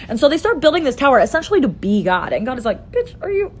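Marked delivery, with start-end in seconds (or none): 2.9-3.5 higher incredulous snap; can't believe their nerve; exasperated. Acted. God exasperated at tower builders.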